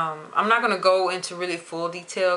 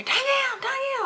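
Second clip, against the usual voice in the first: much higher, calling her name across the office. Retold or imitated. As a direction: shout for Danielle to get her attention.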